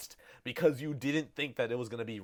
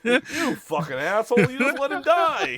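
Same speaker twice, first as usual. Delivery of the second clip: much higher and louder accusing yell; mock outraged shout; 12 semitones higher, 10 dB louder than usual. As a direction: blast the player for letting him die. Voicing the game scolding player.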